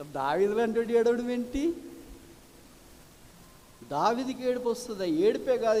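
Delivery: high, loud rhetorical questions to the congregation; fiery preaching. Acted. Preacher asking the church why David lamented, fiery preaching. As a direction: press the church with each question.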